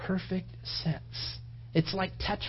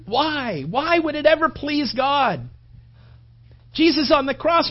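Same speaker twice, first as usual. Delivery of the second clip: higher and louder, fervent sermon build; preaching at full force; 7 semitones higher, 8 dB louder than usual. Acted. Pastor pressing the cross on the congregation, fervent preaching. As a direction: preach it out, pleading with the room.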